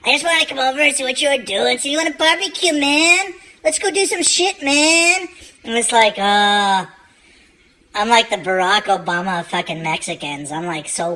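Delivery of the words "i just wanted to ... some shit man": put-on high Mexican-accented cousin. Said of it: "He's like".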